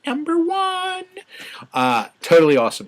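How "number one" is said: much higher put-on voice, drawn out; high mock sing-song tally; assassin counting. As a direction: count the first kill with smug glee. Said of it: "that guy's up there going".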